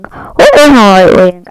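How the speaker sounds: calling out loudly, alarmed; startled loud question. Real, not acted. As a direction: shout it at the friends, startled. Strange thing seen on plane wing.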